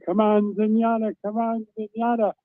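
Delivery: high mock yell at everyday volume; retold screaming cheer. Reporter reliving his own cheering for Zenyatta, high contained mock scream. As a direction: re-enact urging the horse to the line. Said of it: "And I'm screaming and shouting".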